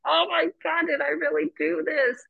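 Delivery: high-pitched cringing exclamation; embarrassed disbelief. Acted. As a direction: react aghast at your own behaviour. Cringing at own past reaction.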